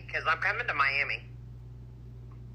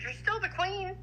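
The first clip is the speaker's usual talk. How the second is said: Seska quoted in a much higher voice; high mock friend voice; 3 dB quieter than usual. Woman quoting her friend Seska, much higher. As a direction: lift way up to play her friend. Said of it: "She goes".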